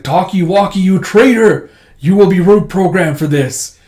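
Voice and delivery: loud, high accusing shout; acted villainous threat; angry denunciation. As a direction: denounce a traitor before an army. Robot army denounces the traitor.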